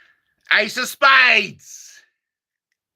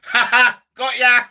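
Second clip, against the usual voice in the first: ordinary delivery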